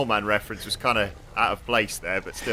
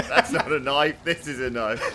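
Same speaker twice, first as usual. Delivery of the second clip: put-on Australian accent; 4 semitones higher, 5 dB louder than usual. Crocodile Dundee quote acted.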